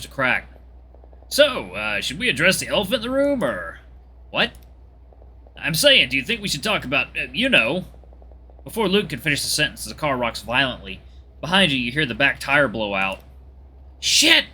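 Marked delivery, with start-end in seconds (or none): 1.3-7.9 lightly voiced Luke, pitch swinging up; awkward friend feeling out a touchy subject; hesitant Luke. Luke breaking the silence with Kieran, hesitant. 14.0-14.4 high, loud yelp; startled swearing. Acted. Driver as the back tire blows out, startled shout.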